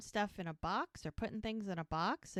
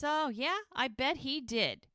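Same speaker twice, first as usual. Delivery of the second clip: higher, drawn-out skeptical comeback; mock sarcasm; 6 semitones higher, 3 dB louder, slower than usual. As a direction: act out a slow, scornful retort. Retold sarcastic comeback in breakup.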